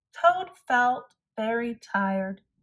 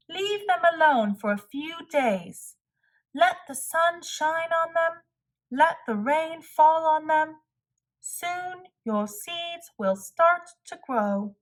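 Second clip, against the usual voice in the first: a lightly voiced Frog, higher, calm advice; higher friendly Frog, patient; Frog advising kindly; 7 semitones higher than usual. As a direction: play Frog gently explaining.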